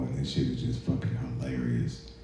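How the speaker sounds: ordinary talk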